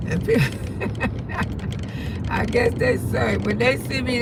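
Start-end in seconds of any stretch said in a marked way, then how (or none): 0.6-2.2 breathy, voiceless chuckle; soft laugh to herself; amused quiet chuckle. Real, not acted. Amused recalling people's reactions.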